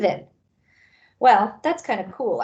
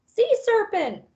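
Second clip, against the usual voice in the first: high panicked shout; scream; 13 semitones higher than usual. Acted. Beagle shrieking at the elephant trunk, high and panicked. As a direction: shriek in fright. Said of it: "screams the beagle".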